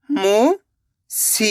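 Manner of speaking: slow teaching read; drawn out slowly. Shown as a demonstration. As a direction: stretch मुसीबत out very slowly. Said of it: "अब आगे देखिए".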